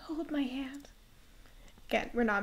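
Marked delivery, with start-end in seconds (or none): 0.0-0.9 softly fading into a whisper; weak, frail plea. Acted. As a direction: plead weakly from a sickbed. Reads sick girl Nina's request.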